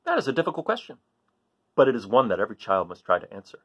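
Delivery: put-on lively read-aloud to children; story reading. A narrator's reading. Teacher reading to kids, lively storytelling voice.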